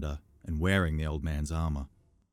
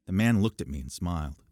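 plain reading voice

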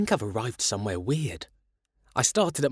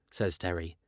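plain reading voice

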